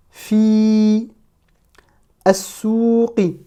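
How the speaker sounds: long vowels held, a pause after each word; sustained, careful Arabic reading; detached word-by-word. Shown as a demonstration. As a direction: read each word alone. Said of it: "chaque mot de manière détachée".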